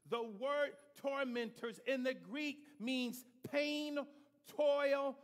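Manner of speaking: slow, loud preaching climax; preaching at full force. Real, not acted. Preacher to congregation, slow loud preaching. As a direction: lean on each word of torment.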